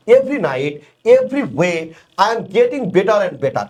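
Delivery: slow, each "every" stressed; teacher reads the repeated phrase slowly; slow example. Shown as a demonstration. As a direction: read the lines slowly, leaning on every "every". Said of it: "তাহলে এই যে দেখেন".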